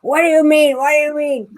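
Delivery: put-on elderly smoker's voice; grandmother's smoker voice. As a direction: imitate a lifelong-smoker grandmother. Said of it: "she talked like this".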